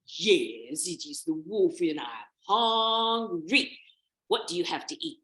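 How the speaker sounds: loud, slow, drawn-out wolf voice; gloating villain growl; menacing wolf. Acted. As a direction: relish the hunger as the big bad wolf. Wolf reveals himself.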